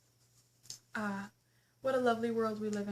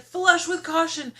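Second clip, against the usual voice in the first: mock warning; 5 semitones higher, 10 dB louder, slower than usual. Mock warning sign, shouted.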